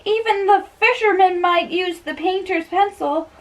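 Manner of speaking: high, rising bewildered rant; high comic servant. Acted. Servant puzzling over the list, high comic voice. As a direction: play the servant's confusion big and high.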